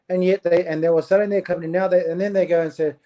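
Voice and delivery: usual speaking voice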